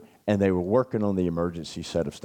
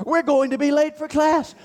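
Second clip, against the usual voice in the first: much higher and a bit louder, frantic; hammed-up panic; 4 dB louder than usual. Roommate running in circles mocking anxiety, frantic panic. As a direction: shriek it like a cartoon worrier.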